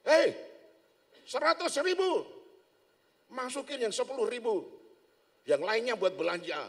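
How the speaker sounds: high, loud coaxing whisperer; tempting devil. Acted. The devil tempting the man, in an urgent coaxing voice. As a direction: lure him to keep the money.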